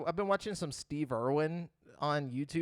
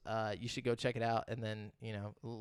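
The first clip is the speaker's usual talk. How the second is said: ordinary delivery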